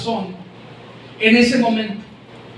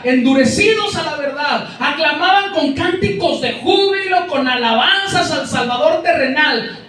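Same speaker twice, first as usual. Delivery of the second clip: fervent preaching; 5 semitones higher than usual. Acted. Preacher rises to full fervour.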